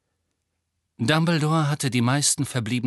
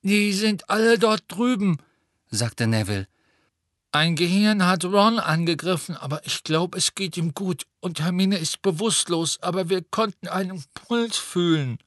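Neville voice; 5 semitones higher than usual. A narrator's reading.

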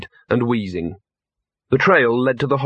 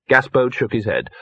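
plain reading voice

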